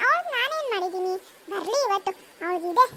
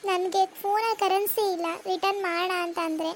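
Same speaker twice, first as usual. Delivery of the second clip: lower, gentle mother voice; 5 semitones lower than usual. Acted. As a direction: comfort the daughter, sad underneath. (ಮಗಳನ್ನು ಸಮಾಧಾನಿಸುವ ಅಮ್ಮ)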